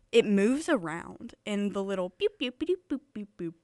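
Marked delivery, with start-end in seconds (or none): 2.4-3.5 playful electronic blips; imitated beeps. Host mimics the moving test graphic, imitated beeps.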